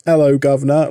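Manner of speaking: mock an American's attempt at a British accent; bad British accent. Mocking Americans who try a British accent, hammed-up Cockney. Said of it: "tries to do a British accent".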